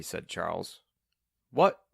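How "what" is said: loud, high, questioning "What?"; raised-voice deaf man's question; hard-of-hearing query. A narrator's reading. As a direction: call it out like someone who can't hear. Hard-of-hearing man fails to hear.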